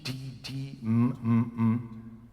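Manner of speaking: ordinary talk, nothing marked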